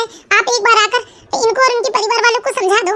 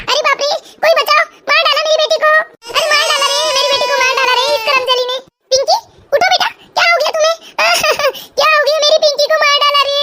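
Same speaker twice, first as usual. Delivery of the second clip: hammed-up wailing mother; 3 semitones higher than usual. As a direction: cry out for help, overacting the grief. Mother fakes a wailing scene. (नाटकीय झूठा विलाप, ऊँची आवाज़ में)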